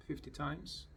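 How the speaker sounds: usual speaking voice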